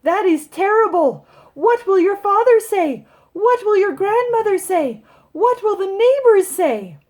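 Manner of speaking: high, loud worried mother; fretting mother. Acted. Mother fretting at her daughter over the ticket, high loud. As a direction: wail over what everyone will say. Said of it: "You know how mothers do that".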